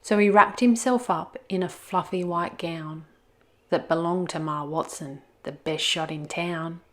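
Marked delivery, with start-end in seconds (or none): none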